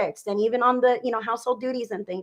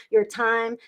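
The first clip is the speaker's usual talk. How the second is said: normal talking voice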